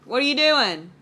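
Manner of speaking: loud, high call. Real, not acted. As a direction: shout it down the hall.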